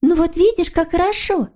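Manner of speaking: put-on high, girlish cheering-up; encouraging. A narrator's reading. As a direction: cheer him up in a high little voice. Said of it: "ободрила его Снорочка". (ободряюще, тонким голоском)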